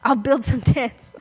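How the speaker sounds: high, gushing eager blurt; overeager Peter. Retold or imitated. Peter rushing to Jesus, high and excited. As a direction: babble it like a giddy disciple. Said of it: "He's like".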